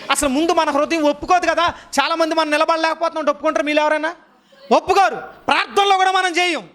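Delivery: high-pitched, insistent sermon delivery; impassioned preaching. Real, not acted. Preacher challenging listeners who won't admit failing, impassioned. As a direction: preach it high, driving the point home.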